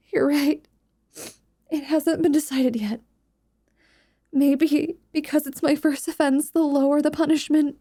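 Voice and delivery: worried student Dolore. Acted. Dolore telling her friend she may be expelled, mildly anxious.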